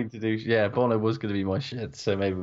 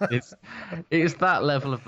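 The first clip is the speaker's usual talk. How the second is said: usual speaking voice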